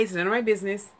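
ordinary delivery

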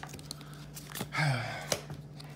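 long, soft breathy exhale; weary sigh. Real, not acted. Opener bracing for four extra packs, weary sigh.

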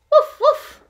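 high yappy puppy woofs; puppy bark. Acted. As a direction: yap like a tiny puppy. Small puppy barks in story.

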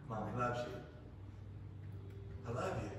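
soft, low mother's reassurance; quiet loving murmur to her son; tender soothing. Retold or imitated. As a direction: hush him with love.

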